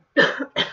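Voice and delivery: sudden harsh loud cough; coughing fit. Real, not acted. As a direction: cough hard mid-sentence. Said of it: "already starting".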